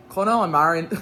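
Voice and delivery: amateur Irish accent, a bit loud; attempted Irish accent. Shown as a demonstration. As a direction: try an Irish accent that slips toward Scottish. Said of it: "should I read this in an Irish accent".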